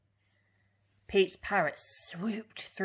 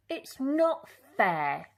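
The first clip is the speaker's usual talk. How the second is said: slow, louder sulky complaint; a touch of a sulking pirate; grumbling Pete; 5 semitones higher, 6 dB louder, slower than usual. A narrator's reading. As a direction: Pete grumbling after the scolding. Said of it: "grumbled Pete".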